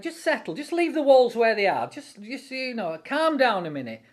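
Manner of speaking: a hint of a sly tempter; enemy's coaxing voice. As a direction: lull them into giving up. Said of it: "he's gonna say, like".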